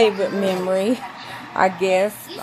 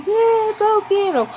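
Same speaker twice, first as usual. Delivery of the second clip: crowd cheering back; 12 semitones higher than usual. Retold or imitated. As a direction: call it out like the whole audience cheering. Crowd calls out to the man.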